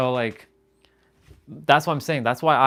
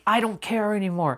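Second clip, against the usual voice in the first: higher, careless letting-go voice; Vegeta giving up; 7 semitones higher than usual. Retold or imitated. Vegeta finally letting go, higher careless tone. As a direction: toss it off like you've stopped caring. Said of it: "He's like".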